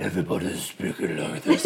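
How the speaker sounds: low, muddy mumble in a thick mock accent; mock Game of Thrones accent. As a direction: exaggerate a deep, hard-to-follow fantasy-drama accent. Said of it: "they're so deep in these accents".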